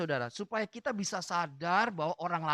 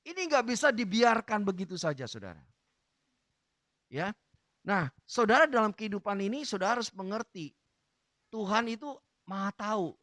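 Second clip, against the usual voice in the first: ordinary talk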